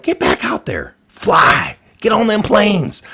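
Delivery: high, slow mock plea from Bush; imitating a president urging the nation to fly; pleading president. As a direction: beg people back onto planes, hammed-up. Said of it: "he was pleading with American people".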